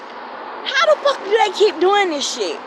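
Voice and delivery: high, slow incredulous outcry; exasperated disbelief. Retold or imitated. Telling viewers about the police coming again, slow and high. Retelling repeated police visits.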